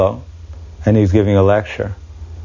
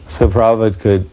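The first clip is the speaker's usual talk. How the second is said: ordinary talk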